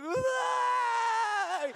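high loud emotional wail; overwhelmed cry. Retold or imitated. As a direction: cry out as the tough groom sees his bride. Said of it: "He's like".

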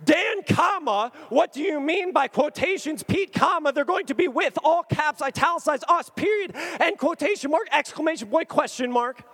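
high, loud, incredulous read-out; mock frantic disbelief. Typing back to best friend, high incredulous excitement. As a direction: build the punctuation into an excited outburst.

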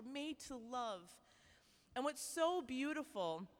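ordinary talk, nothing marked

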